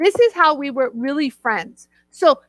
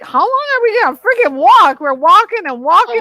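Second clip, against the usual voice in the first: louder, higher griping; exasperated complaint; 5 semitones higher, 5 dB louder than usual. Retold or imitated. Woman replaying her complaint on the long walk, exasperated. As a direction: exaggerate being fed up with the endless trek.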